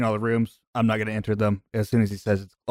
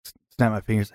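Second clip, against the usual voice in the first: usual speaking voice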